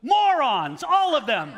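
loud, high, sneering dismissal; Vizzini's scorn. Retold or imitated. Vizzini dismissing great philosophers, loud sneering scorn. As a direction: spit out the verdict like a smug genius. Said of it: "And he goes".

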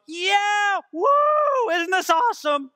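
high, loud party whoop; mock excited cheer. Preacher mocking how people would not greet God, whooping excitedly. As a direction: hoot like a thrilled fan, far too eager. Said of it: "we wouldn't be going".

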